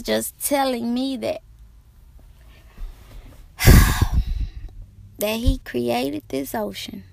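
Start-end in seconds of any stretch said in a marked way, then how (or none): 3.6-4.8 loud unvoiced sigh; a big tired sigh before going on; heavy sigh. Real, not acted. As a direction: let out a long weary breath.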